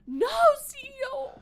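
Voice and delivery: shrieked high and loud; loud cry. Real, not acted. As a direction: cry out in outrage. Outburst after cough.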